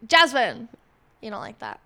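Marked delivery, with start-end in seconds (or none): 0.1-0.7 loud call of Jasmine; mock shout of her name. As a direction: yell the name across the room. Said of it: "when I'm like".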